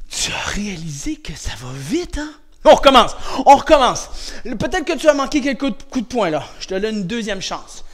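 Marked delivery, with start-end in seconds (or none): 0.1-2.3 breathless whisper. Real, not acted. Out of breath after the drill.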